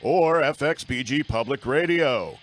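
emphatic, projected radio announcer read; big radio-intro voice; announcer disclaimer. Acted. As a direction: deliver a formal show warning, drawing out the phrase endings. Reads the show's opening disclaimer.

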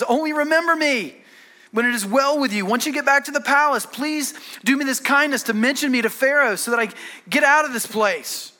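earnest pleading with pitch lifted. Acted. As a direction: beg a favor in a high, urgent preacher's retelling. Acting Joseph pleading with cupbearer.

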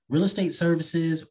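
ordinary delivery

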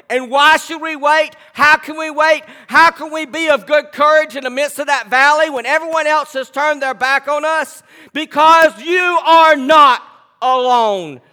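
loud, high, slow preaching. Acted. Pastor preaching scripture to the church, full force. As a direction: preach it out to the congregation.

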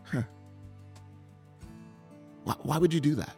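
usual speaking voice

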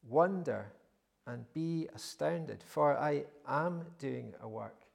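loud, slow, weighty reading of God's words; solemn reading. Acted. Preacher reading the Lord's answer to Habakkuk, solemn. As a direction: proclaim it with weight.

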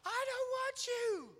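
high, slow tantrum shriek, only partly loud; mimicked child's scream. Dad mimicking his bear-hugged daughter, high drawn-out scream. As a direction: imitate a little girl wailing in protest. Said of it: "At the top of her lip".

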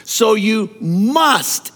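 "must" hit hard and slow; slow down and punch "must"; stress on must. Shown as a demonstration.

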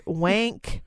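usual speaking voice

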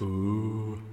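echoing ooh through a mic effect. Real, not acted. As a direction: let the echo ring on the ooh. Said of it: "Am I echoing?".

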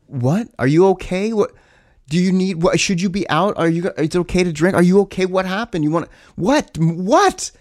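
high, rapid, alarmed questions tumbling out; shocked and worried, firing question after question; alarmed disbelief. Retold or imitated. Told about being roofied.

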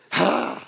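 sharp breathy exhale; cold-shower gasp. Acted. Man braving a cold shower, gasping. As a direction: gasp as if icy water hits.

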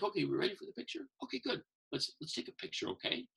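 soft, quick, sinking to a whisper; gentle coaxing. Retold or imitated. Santa softly coaxing a nervous kid toward the photo, hushed. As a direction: hush it down to just the kid.

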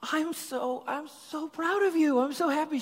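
high, loud, overjoyed gushing; gushing pride. Retold or imitated. Dad gushing pride to his daughter, high and loud. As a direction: pour out proud joy at her. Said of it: "I'm like".

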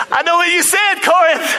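playful high-pitched challenge; Paul. Acted. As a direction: call out a church as a hyped-up apostle. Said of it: "that's kinda what Paul is doing".

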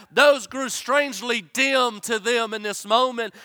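high, loud fervent preaching; preaching at full force. Acted. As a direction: drive the storm story home with fire. Preacher at full force, storm story.

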